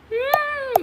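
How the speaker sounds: high-pitched silly giggle; real goofy laugh with a high whine. Real, not acted. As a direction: laugh ending in a high squeal. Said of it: "my laugh is so silly".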